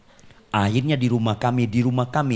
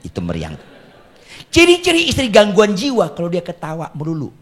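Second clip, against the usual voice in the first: ordinary talk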